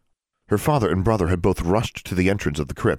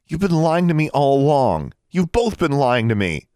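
louder, higher accusing cry; angry accusation; 8 semitones higher, 4 dB louder than usual. Acted. Daughter accusing her father and brother of lying, angry. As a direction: throw the betrayal at them.

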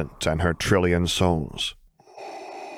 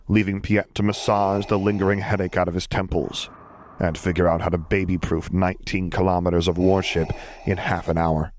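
usual speaking voice

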